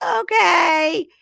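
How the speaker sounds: high, loud okay; mock eager agreement. Voices Lisa's anxious agreement.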